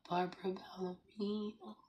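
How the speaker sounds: playful whisper of a nickname; whispered sing-song. Shown as a demonstration. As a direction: breathe the name playfully. Cooing at a viewer by name.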